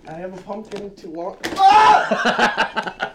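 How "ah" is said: laughing shout. Real, not acted. Laughing outburst at pumpkin joke.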